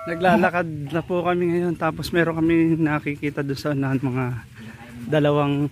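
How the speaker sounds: tired, low-energy voice while walking; worn out from the hike, a bit breathless; exhausted. Real, not acted. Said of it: "medyo pagod na kami".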